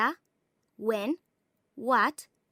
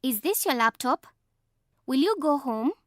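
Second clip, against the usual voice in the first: yes-no question, rising at the end on "laptop" and "home"; rising intonation; 5 dB louder than usual. Shown as a demonstration. Narrator demonstrates yes-no questions for learners, with rising intonation on "laptop" and "home". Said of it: "A question that needs a yes or no answer".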